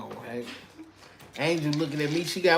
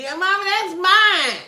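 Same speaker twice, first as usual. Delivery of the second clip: high, loud, slow whine; whiny kid voice; 12 semitones higher, 7 dB louder, slower than usual. Retold or imitated. Mocking mom's future kid voice, a high whine. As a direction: whine like a child claiming the TV. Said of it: "You gonna be".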